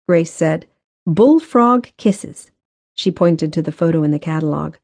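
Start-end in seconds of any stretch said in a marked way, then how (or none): 1.0-2.5 high, slow reveal of the name; playfully drawn out. A narrator's reading. Grace shows Benton a silly flower name, playfully drawn out. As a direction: stretch it out slowly, pitched up.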